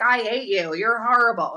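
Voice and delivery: loud mock insults from haters; hateful viewers. Streamer quoting people who tune in to complain, mock hateful. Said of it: "other people that are like".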